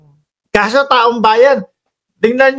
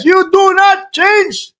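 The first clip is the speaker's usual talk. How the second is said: mimicking the raised voice, slow and high; mock raised voice; 13 semitones higher, much slower than usual. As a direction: drag it out high like a mocking echo. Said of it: "tumataas ang boses".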